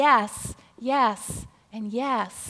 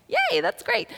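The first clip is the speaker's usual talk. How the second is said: high, bright mock cheer; playful high-pitched yay; excited cheer; 11 semitones higher than usual. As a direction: squeal it like an excited kid. Cheering a sample offer.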